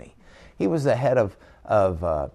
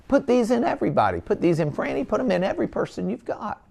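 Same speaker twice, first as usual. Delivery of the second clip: much higher and louder, fast hyped-up pitch; mock sales pitch; 11 semitones higher, 3 dB louder than usual. Mocking the company's ad to doctors, hyped-up and much higher. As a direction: sell it like an overexcited ad man. Mocking the hip ad's message.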